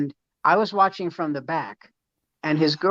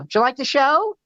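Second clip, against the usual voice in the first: mock cheerful; 10 semitones higher, 4 dB louder than usual. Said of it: "I go".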